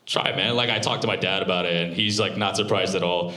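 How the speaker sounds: ordinary talk, nothing marked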